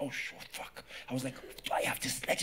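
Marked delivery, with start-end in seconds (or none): 0.0-0.8 breathless whispered curse; a stunned, whispered oh no; whispered shock. Retold or imitated. Shocked at diagnosis. 1.7-2.4 breathless whispered realisation; a shocked whisper to himself; whispered panic. Retold or imitated. Panicking at diagnosis.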